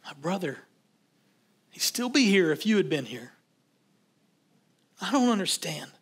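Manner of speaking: grieving sister, quiet reproach with long pauses; heartbroken, accusing. Retold or imitated. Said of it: "she was too heartbroken".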